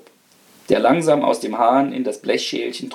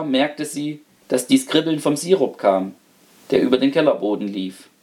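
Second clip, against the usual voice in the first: plain reading voice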